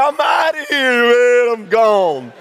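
high, loud panicked yell; panicked exit. Retold or imitated. Wife bolting from the rats, high panicked yell. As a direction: yell it while fleeing the barn. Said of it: "She left her kids in there to die".

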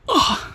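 very high shrill yelp of pain. Acted. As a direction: yelp as if struck hard. Hit by a kick.

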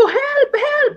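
imitating calling out to the soldiers; a lightly voiced, high call for help; retold cry for help. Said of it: "sumigaw rin po ako".

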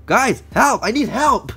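high, loud plea; calling for help. Real, not acted. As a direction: cry out for rescue. Calls to viewers for help.

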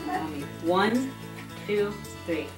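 normal talking voice

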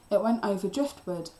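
plain reading voice

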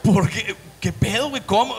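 halting, mock-panicked questions, starting high; acted flustered disbelief; nervous. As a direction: stammer out alarmed inner questions. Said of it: "todo ese nervio".

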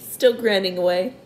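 usual speaking voice

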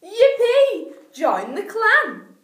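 high, loud yippee, slow join the clan; joyful shout. A narrator's reading. Three animals welcoming the warthog, loud cheer. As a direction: whoop it out. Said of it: "the other three shouted".

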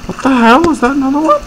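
high-pitched startled disbelief; sudden squeaky double-take; real surprise. Real, not acted. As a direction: blurt it out high, caught off guard. Startled by an unexpected find.

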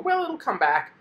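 high-pitched mock optimism, brushing off the loss; hopeful denial. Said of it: "and you go".

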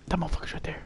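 whispering. Real, not acted. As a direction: whisper it, enemy close.

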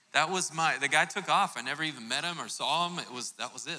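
usual speaking voice

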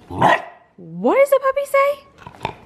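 very high, slow sing-song coax; coaxing puppy voice. Real, not acted. To Doyle, begging him to bark on camera, in a high, drawn-out sing-song. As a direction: lift way up and stretch it out to coax a bark.

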